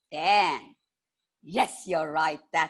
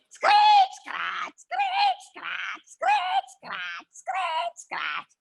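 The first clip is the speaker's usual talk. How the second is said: screech and scratch; much slower than usual. A narrator's reading. Narrator mimics the chair screeching and scratching.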